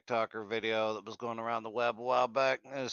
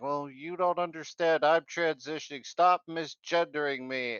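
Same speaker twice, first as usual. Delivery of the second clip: mock screaming and crying; 6 semitones higher, 4 dB louder than usual. Speaker mimics kid protesting to mom, mock screaming and crying. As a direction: imitate a wailing kid, exaggerated. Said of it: "the kid's screaming and crying".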